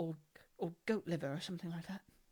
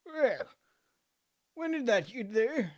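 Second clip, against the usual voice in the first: surprised; 7 semitones higher, 8 dB louder, slower than usual. Acted. Startled old man.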